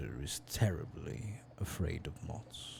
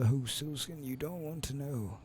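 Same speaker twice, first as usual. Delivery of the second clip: put-on old man voice; 8 semitones higher than usual. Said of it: "give him an old man voice".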